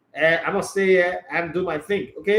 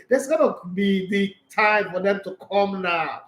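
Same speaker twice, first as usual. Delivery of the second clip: normal talking voice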